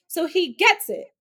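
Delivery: usual speaking voice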